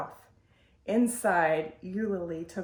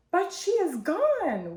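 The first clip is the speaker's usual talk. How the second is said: wailing; 9 semitones higher, 4 dB louder than usual. A narrator's reading. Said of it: "wailed Mother Lumps".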